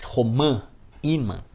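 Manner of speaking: final Ã of "Romã" and "imã" clearly nasalized; vogal nasal. Shown as a demonstration. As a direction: ring the nasal last vowel in "Romã", "imã". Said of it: "som nasalizador de vogais". ("Romã" e "imã" com o ã final bem nasalizado)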